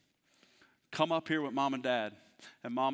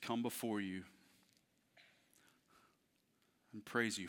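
quiet prayer; 4 semitones lower, 5 dB quieter than usual. Acted. Closing prayer.

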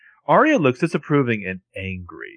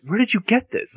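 shocked question; 10 semitones higher than usual. Retold or imitated. Retelling Spencer's shocked question.

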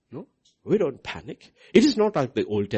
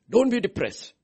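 usual speaking voice

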